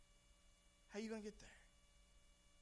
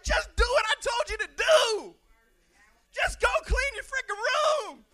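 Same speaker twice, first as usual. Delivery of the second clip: exasperated shout; 16 dB louder than usual. Retold or imitated. Jesus voiced exasperated.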